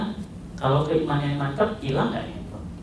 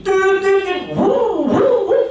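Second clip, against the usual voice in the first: high, loud "tin tin" horns and "wuh" cries; imitated honking; 9 dB louder, slower than usual. Preacher acting out drivers honking in Mecca, loud mock horns. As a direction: blare it like impatient drivers.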